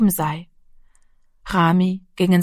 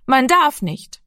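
put-on higher-pitched little boy's protest; reluctant small boy; 6 semitones higher, slower than usual. A narrator's reading. Rami answers his pressing big brother, reluctantly firm. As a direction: insist timidly that it is forbidden.

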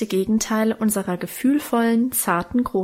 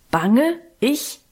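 grollend; 3 semitones higher than usual. Acted. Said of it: "grollte Karl".

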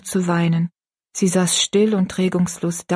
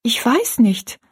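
put-on higher, mildly fearful girl's answer; timid; 5 semitones higher, 3 dB louder, slower than usual. A narrator's reading. Rosalie answering her stepmother, timid and higher. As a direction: answer timidly in a raised little voice. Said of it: "antwortete Rosalie furchtsam".

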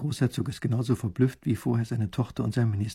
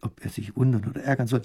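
ordinary delivery, nothing marked

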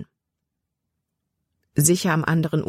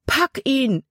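high urgent shout; called out; 9 semitones higher than usual. A narrator's reading. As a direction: urge her to grab the branch. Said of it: "rief nochmals".

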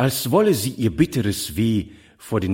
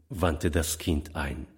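ordinary delivery

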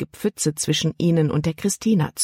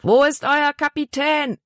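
high-pitched, demanding call; a moderate bellow, pitched well up; 10 semitones higher than usual. A narrator's reading. Big man demands the captain from the boy, bellowing. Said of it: "brüllte der große Mann".

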